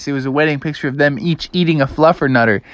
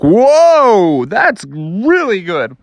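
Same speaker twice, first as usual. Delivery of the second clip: a louder, higher "Whoa", then slow, savoring words; amazed tasting; 6 semitones higher, 4 dB louder, much slower than usual. A narrator's reading. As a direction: savor it like a first taste of candy. Froggy tasting the fluff.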